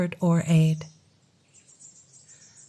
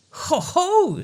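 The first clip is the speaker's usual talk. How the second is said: mocking ho ho; 11 semitones higher than usual. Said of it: "said the fairy".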